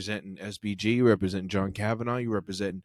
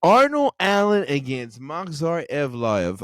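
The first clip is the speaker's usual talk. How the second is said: loud, slow, drawn-out emphasis; emphatic, triumphant I-told-you-so; excited hype; 3 semitones higher, 6 dB louder, slower than usual. Real, not acted. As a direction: savor every word like a hype man. Hyping fight, boasting of prediction.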